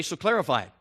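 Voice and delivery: ordinary delivery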